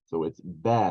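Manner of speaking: "bana" com a vogal aberta e leve; bana pron. Shown as a demonstration. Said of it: "as it should be read".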